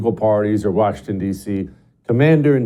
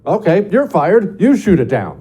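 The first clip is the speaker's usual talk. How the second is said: louder, curt commanding voice; president firing someone; 7 semitones higher, 4 dB louder than usual. Retold or imitated. The president firing an officer who refused, curt loud order. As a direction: dismiss one man and turn to the next.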